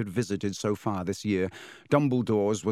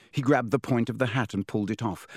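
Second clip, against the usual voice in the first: plain reading voice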